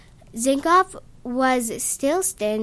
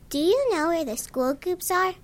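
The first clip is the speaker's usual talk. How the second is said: put-on higher, bright little kid asking; happy high kid voice; 7 semitones higher than usual. A narrator's reading. Little kid asking the narrator for help, high and happy. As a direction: chirp it like a cheerful small child. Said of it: "still in a happy-sounding, high-pitched voice".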